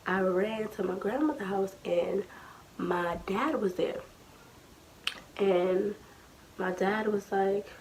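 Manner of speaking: usual speaking voice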